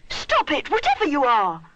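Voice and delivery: high, loud call; shout. A narrator's reading. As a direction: Rupert shouting at the creature. Rupert shouts on.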